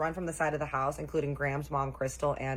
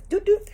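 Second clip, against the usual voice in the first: very high, loud imitated beeps; two squeaky little alert noises; antenna-popping beeps; 8 dB louder than usual. Mimicking the mom's antenna going up, high alert beeps. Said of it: "whiskers and antenna go up like this".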